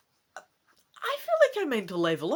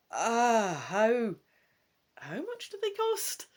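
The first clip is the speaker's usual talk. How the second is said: higher, anxious questioning voice; young apprentice asking; 4 semitones higher, slower than usual. Acted. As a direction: ask the price nervously. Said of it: "You ask".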